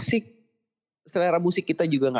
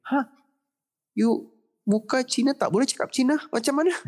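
put-on high incredulous Malaysian uncle, Chinese Malaysian accent; playing the shocked taxi uncle in a Chinese Malaysian accent; 12 semitones higher than usual. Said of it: "Supir taksi boy dulu ya".

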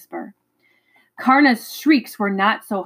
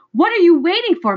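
loud, high, urgent yell; frantic high shout at a child; 9 semitones higher, 5 dB louder than usual. A narrator's reading. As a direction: scream the question across the lawn. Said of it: "Missus Griffin yelled".

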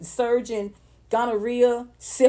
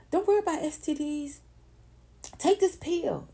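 high, softened sales voice; high, mock-reassuring salesperson; mock pill pitch; 5 semitones higher, 5 dB quieter than usual. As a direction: coax like a drug advert, pitched up. Imitates sellers pushing a pill.

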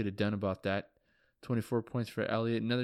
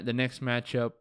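ordinary talk

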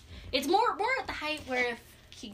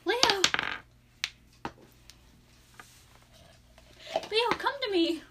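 higher, searching call; wife calling out; 4 semitones higher, slower than usual. Acted. Wife calling her husband in the dark, higher call. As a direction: call for your husband, pitched up. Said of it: "call out to you".

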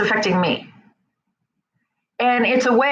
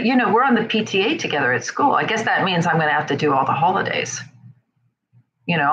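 normal talking voice

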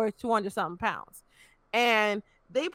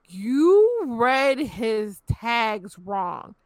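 very slow, drawn-out incredulous quote; retold dumbfounded reaction, dragged right out; stunned disbelief; 3 dB louder, much slower than usual. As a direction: stretch each word in appalled disbelief. Stunned reply to cops' flimsy excuse.

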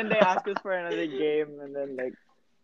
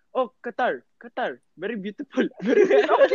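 teasing imitation of friend's mock Arabic accent, laughing; put-on Arabic accent; 4 semitones higher, 3 dB louder than usual. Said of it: "Do you know what you were saying?".

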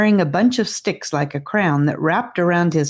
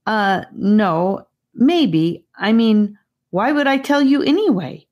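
rising voice; 6 semitones higher than usual. Acted. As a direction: get more rattled with every phrase. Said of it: "Her voice was rising".

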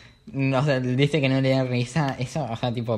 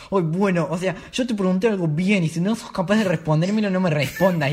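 higher, louder indignant snap; mock angry retort; 6 semitones higher, 3 dB louder than usual. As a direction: storm off at the dad. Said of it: "me fui enojado". (respuesta indignada, más aguda y fuerte)

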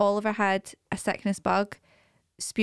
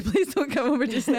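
laughing; 4 semitones higher than usual. Retold or imitated. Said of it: "imagine him just sitting there going".

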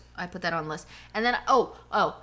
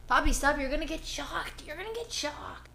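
soft pet warning; 5 semitones higher, 4 dB quieter than usual. Real, not acted. Warning the dog off the mic.

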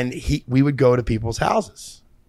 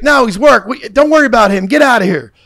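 higher, louder shout of get off; angry homeowner; 9 semitones higher, 7 dB louder than usual. Retold or imitated. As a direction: bark at strangers on his lawn. Angry homeowner shoos them away.

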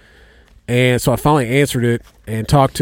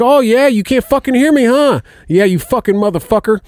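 imitating a much higher, louder sneering yell; retold furious yell; 11 semitones higher, 4 dB louder than usual. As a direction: shout it like a scammer who caught the trick. Scammer curses at him.

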